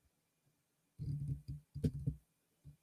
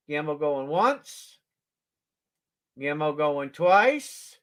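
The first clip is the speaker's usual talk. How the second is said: slow drawn-out going once, going twice; slow auctioneer call; slower than usual. Real, not acted. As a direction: stretch the call for suspense.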